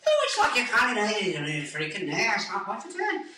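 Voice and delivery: joking Scottish-style accent, swooping pitch; put-on accent. Shown as a demonstration. Voicing things just for fun, jokey Scottish-style accent. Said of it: "if you do the accents and stuff".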